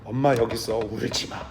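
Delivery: soft, hushed comfort fading to a whisper; calm a crying toddler gently; tender soothing mother. Pretend.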